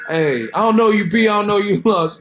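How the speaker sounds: high, loud, held-up exclamation; loud high-pitched mock disbelief; high mock catchphrase. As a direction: push it way up high and hold it there. Repeats a mocking high catchphrase.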